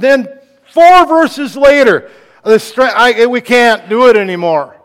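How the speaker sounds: high, loud despairing preaching; preaching climax. Acted. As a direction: rise to despair as strength fails. Preaching at building intensity.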